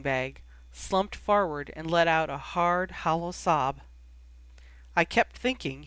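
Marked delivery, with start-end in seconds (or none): none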